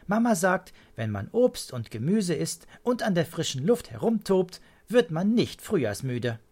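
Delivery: put-on high, loud child's voice; emphatic childlike lecturing; know-it-all child. A narrator's reading. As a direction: recite mum's advice with conviction.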